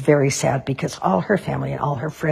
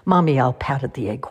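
ordinary delivery, nothing marked

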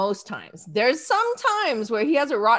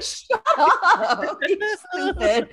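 laughing tease; 4 semitones higher, much slower than usual. Retold or imitated. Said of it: "And I was like".

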